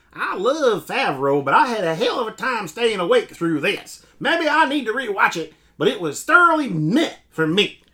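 put-on Southern accent. Reading a viewer from the deep south, a light Southern accent. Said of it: "from the very far deep south".

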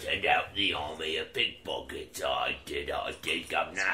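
lightly done low Cockney voice; a hint of a low Cockney villain; Cockney accent. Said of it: "My Cockney accent".

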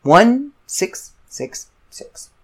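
each digit weighted, slowly; slow, ominous digits. Real, not acted. Stressing the devil's number to the listener, slowly.

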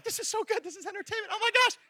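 mock enthralled viewer. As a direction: gush over the show. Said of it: "we're like".

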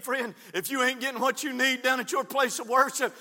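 ordinary talk

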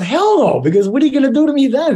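high, loud, fast mock panic; mock alarm. Joking with the anesthesiologist about sedation, high mock panic. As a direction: yelp it out in mock fright, joking with the nurse. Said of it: "I said".

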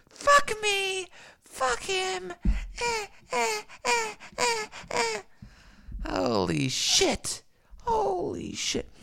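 very high, squeaky cursing; shrill mock panic; mock falsetto squeal. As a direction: squeal the curses like a cartoon.

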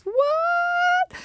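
very high, held celebratory whoop; party whoop. Acted. Party whoop after party announcement.

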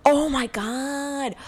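high, long-drawn swoon; drawn-out fan reaction; real gushing excitement. Real, not acted. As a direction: stretch it out high, thrilled by the name. Reacting to mention of Ed Norton.

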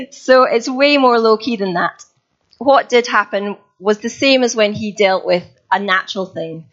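ordinary talk

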